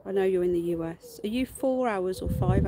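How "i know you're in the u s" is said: ordinary talk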